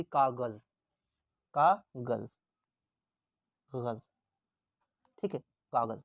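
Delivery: कागज़ ending in a buzzing ज़ as in zoo; कागज़ with nukta. Shown as a demonstration. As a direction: end कागज़ on ज़. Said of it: "इसको जे पढ़ना है". (कागज़ में ज़ की आवाज़)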